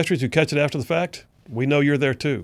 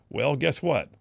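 ordinary talk, nothing marked

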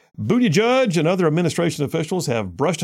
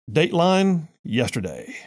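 ordinary talk, nothing marked

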